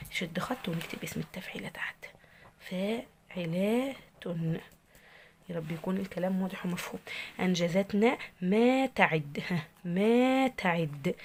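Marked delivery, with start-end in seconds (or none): 2.6-3.9 very slow, spaced-out dictation; syllable by syllable. Shown as a demonstration. Teacher dictating the foot for students to write, slow syllable by syllable. 7.4-11.1 verse words drawn out with long vowels; slow sounding-out. Shown as a demonstration. Teacher reading the next foot for students, slowly sounded out.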